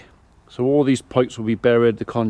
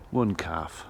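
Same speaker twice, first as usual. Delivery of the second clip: ordinary delivery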